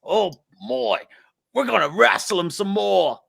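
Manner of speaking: put-on high-pitched Australian accent; undaunted Aussie croc hunter, hammed up; Steve Irwin. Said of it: "He's like".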